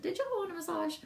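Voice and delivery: put-on high-pitched Southern accent; best Southern accent. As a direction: ham up a Southern accent for the question. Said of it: "In my best Southern accent".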